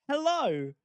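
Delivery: ordinary delivery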